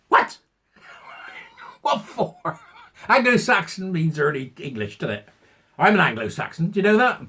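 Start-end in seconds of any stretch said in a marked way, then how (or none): 0.1-2.6 breathy laughing what for; laughing disbelief. Real, not acted. Ranting vlogger laughing at the news.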